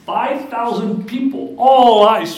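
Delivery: loud, high dramatic exclaim; booming emphasis. Real, not acted. Preacher booming the crowd's reaction to the congregation. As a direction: boom it out to the crowd.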